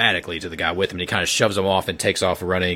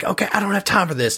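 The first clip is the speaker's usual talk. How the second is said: much higher and fast, brusque; much higher, brisk, hurried character voice; hurried Davis; 12 semitones higher, faster than usual. Acted. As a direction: cut him off and rush.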